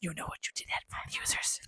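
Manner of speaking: hushed scolding whisper; mock whisper. A player whispering a mock scolding at headphone listeners. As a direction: whisper a mock accusation at them.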